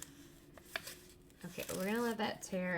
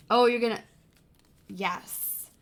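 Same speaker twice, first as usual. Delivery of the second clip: usual speaking voice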